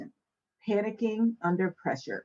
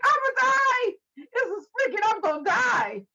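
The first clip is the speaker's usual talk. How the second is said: high, loud dramatic wail; mock panic; 4 dB louder than usual. Imagining telling Gina about a sprinkle, mock wail. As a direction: overreact to a sprinkle. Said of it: "can you imagine if I said to Gina".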